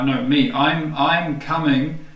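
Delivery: ordinary talk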